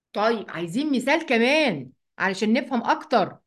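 ordinary talk, nothing marked

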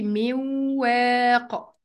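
"من واق" as miwww-waaq, no n; nun merged into waw. Shown as a demonstration. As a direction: hold a doubled nasal w across "من واق". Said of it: "هتتنطق إزاي؟".

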